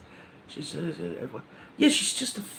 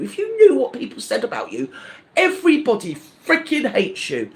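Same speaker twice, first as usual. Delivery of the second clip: higher, louder furious telling-off; angry shout; 5 semitones higher, 6 dB louder than usual. Real, not acted. Ranting at the online rival directly, furious shout. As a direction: let rip at her, shouting. Rant addressed straight at her.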